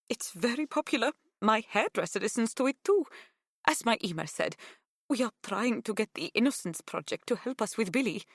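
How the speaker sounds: put-on high, lilting Greek-accented woman, happy and grateful; earnest, excitable Greek-accented phone voice; effusive Greek mother. A narrator's reading. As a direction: gush hopefully in a Greek accent, hardly stopping for breath.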